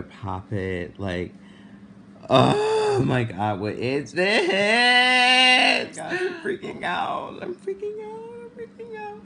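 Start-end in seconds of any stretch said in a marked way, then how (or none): 2.3-9.2 starts slow and louder, climbing far higher, then fading into a thin teary squeak; panicked wail. Real, not acted. Showing viewers the bump, she wails in rising panic, near tears. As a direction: let the fear spiral up into a whimper.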